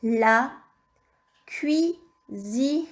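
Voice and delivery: "La cuisine" split syllable by syllable; word dictation. Shown as a demonstration. Teacher dictating the first word, slow and segmented. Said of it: "Premier mot".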